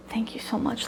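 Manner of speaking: breathless hushed thank-you; imitating grandma's whispered thanks. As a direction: whisper your relief and gratitude. Grandma thanking the kind lady.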